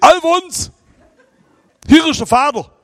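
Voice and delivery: high, loud call down the phone; a loud, excited old father's hail; booming father's greeting. Retold or imitated. Old father calling son late at night.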